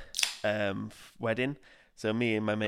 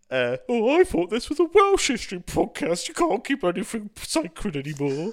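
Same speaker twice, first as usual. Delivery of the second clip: high, loud Cockney put-on voice; hammed-up Cockney complaint; mock Cockney outrage; 12 semitones higher, 8 dB louder than usual. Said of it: "that Cockney voice".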